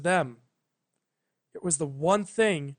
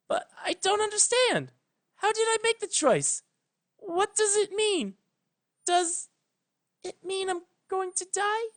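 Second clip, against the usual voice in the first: put-on much higher, young frightened mare; frightened young Twilight. Twilight begs Celestia to explain, high and frightened. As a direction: plead like a scared young pony, high and fast.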